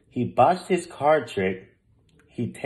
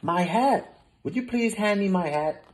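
excited; 8 semitones higher than usual. A narrator's reading. Milo spots his hat.

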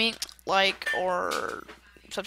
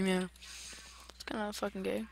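ordinary talk, nothing marked

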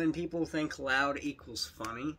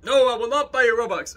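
loud, higher-pitched mock refusal; imitated refusal; 6 semitones higher, 10 dB louder than usual. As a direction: act out an indignant no. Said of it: "And then she's like".

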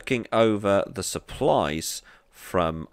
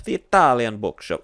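louder, higher hammed-up Italian accent; mock Italian accent; 5 semitones higher, 9 dB louder than usual. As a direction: put on a playful stage Italian accent. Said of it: "never gonna do that accent again".

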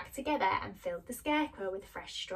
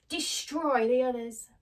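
slow, louder witch's order; wicked witch commanding; 3 semitones higher, 5 dB louder than usual. Acted. Witch sends monkeys after the others, commanding. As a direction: draw out the command with relish.

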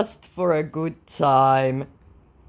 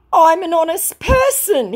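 much higher, slow and louder; mock scammer voice; 7 dB louder than usual. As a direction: ham up the false honesty. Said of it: "he said".

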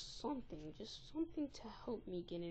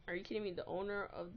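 ordinary delivery

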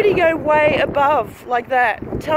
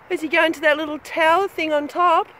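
ordinary delivery

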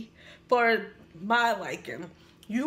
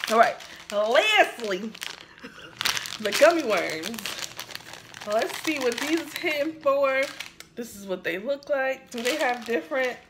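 usual speaking voice

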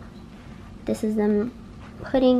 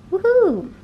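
cheering; 10 semitones higher, 5 dB louder than usual. Real, not acted. Celebrating surgeries are over.

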